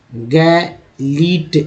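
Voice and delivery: गैलीट drawn out very slowly with a rising end; teacher slowly sounding out गैलीट; very slow demo reading. Shown as a demonstration. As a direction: stretch गैलीट out so students hear each sound. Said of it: "ध्यानपूर्वक देखेंगे समझ में आएगा कि कैसे पढ़ा गया है".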